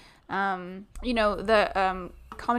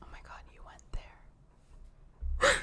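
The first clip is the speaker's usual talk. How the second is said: shocked whisper; 7 dB quieter, much slower than usual. Real, not acted. Shocked reaction to a joke.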